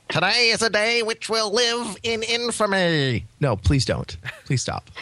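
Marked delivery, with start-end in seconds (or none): 0.1-3.2 high, slow, drawn-out oratory; hammed-up wartime president speech; Franklin Delano Roosevelt. Co-host parodies the host, slow hammed-up presidential impression. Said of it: "really bad Franklin Delano Roosevelt impersonator".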